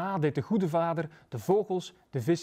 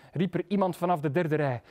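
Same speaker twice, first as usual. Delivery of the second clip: plain reading voice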